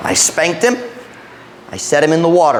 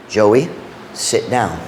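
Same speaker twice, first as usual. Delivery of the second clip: stern father; 7 semitones lower, slower than usual. Retold or imitated. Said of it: "But you know what it was?".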